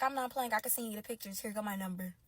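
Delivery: very soft, matter-of-fact quote; quietly voiced text message; imitating the other woman. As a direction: keep it low and soft. Acts the other woman's texts.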